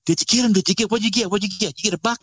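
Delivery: high, eager questions, voice jumping up; a brother bursting to hear about the kill; excited brother. Retold or imitated. Brother excitedly asking about hunt.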